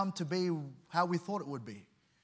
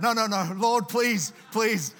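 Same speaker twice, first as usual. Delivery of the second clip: louder, higher desperate pleading; loud begging; 4 semitones higher, 7 dB louder, slower than usual. Retold or imitated. Man on the roof loudly begging the Lord, not the boat. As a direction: beg the Lord at full voice. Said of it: "And he started to begging".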